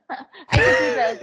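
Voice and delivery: high, slow laugh through the words; laughing it out, delighted. Real, not acted. Laughing at the comparison.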